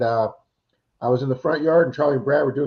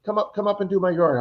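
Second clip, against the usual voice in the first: higher, keen and friendly; eager invitation; 7 semitones higher than usual. Retold or imitated. Homeowner eagerly invites the digger to do the yard, higher. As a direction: invite him up with enthusiasm.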